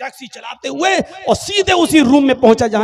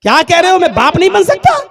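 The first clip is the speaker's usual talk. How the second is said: shocked disbelief; 8 semitones higher, 8 dB louder than usual. Acted. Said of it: "इसका दिमाग चकराया".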